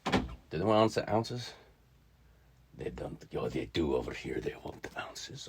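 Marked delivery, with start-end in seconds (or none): 2.8-5.5 whispered aside to self. Real, not acted. Teacher whispering to herself over the sheet. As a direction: mutter it under breath.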